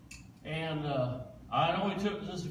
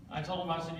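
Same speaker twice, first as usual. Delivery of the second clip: ordinary talk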